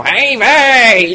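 a hammed-up cry; mock shout. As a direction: shout it high as a caricature. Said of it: "Oni tak właśnie krzyczą".

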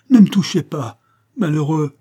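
loud, high cry of dread; frightened cry. A narrator's reading. Sick woman recoiling from her rescuers, frightened shout. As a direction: cry out to ward someone off. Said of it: "cria-t-elle".

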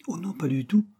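high, eager denial; impulsive, lifted protest. A narrator's reading. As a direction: jump in brightly to reassure. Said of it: "répondit Frank avec élan".

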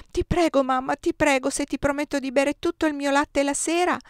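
put-on a little boy pleading with all his heart; child begging mother. A narrator's reading.

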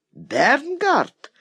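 name thrown back as a high question; incredulous echo. A narrator's reading. Indignant echo of accuser's name.

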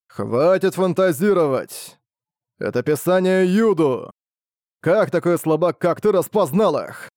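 a scornful devil booming in his head; menacing demonic voice. A narrator's reading. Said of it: "Раздался чей-то грозный дьявольский голос". (грозный дьявольский голос, медленно и свысока)

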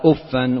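أفا with doubled f and an "-an" ending; fatha with tanween. Shown as a demonstration. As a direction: pronounce أفا as "uffan". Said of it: "وضمها وكسرها بتنوين وبغير تنوين".

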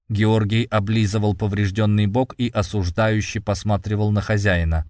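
ordinary delivery, nothing marked

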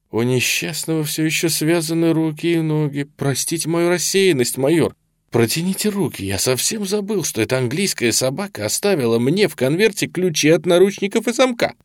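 high-pitched, fussing apology; remorseful. A narrator's reading. Colonel excusing himself to the handcuffed major, remorseful. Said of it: "с каким-то раскаянием в голосе".

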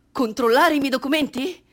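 high, loud incredulous retort; indignant challenge. Acted. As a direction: throw the question back. (sfida incredula, alta e forte)

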